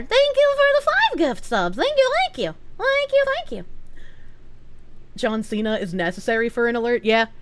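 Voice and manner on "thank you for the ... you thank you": very high, cutesy thank-yous; high sing-song thanks. Acted. Streamer thanking a donor for five gift subs, high chirp.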